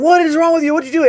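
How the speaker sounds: high-pitched imitation of an angry yell; retold yelling. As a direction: mimic a woman yelling accusingly, pitch jumped right up. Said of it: "she's yelling".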